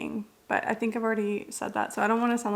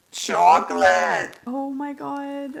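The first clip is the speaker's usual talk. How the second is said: drawn-out, much louder squeal of joy; delighted exclamation; 4 semitones higher, 6 dB louder, slower than usual. Real, not acted. Opening a book package, spotting chocolate, loud drawn-out delight.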